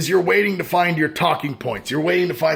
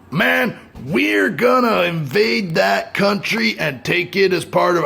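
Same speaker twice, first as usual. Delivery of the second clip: higher, drawn-out mock bravado; mock would-be invader; 4 semitones higher than usual. Vlogger imitating America planning to invade, mock bravado. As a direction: puff it up as a cocky invader. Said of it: "your country set out to say".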